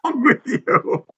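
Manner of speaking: high, slow laughing disbelief; what is wrong with you, laughing in disbelief; incredulous laugh. Real, not acted. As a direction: laugh it out in shock. Shocked by rude message.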